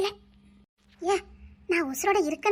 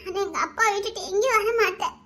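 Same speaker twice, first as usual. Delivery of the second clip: usual speaking voice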